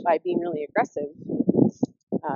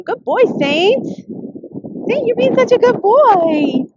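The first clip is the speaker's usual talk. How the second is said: doggy praise voice; 12 semitones higher, 9 dB louder, much slower than usual. Real, not acted. Owner interrupts her story to praise Saint, high slow baby talk. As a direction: beam at him as he behaves.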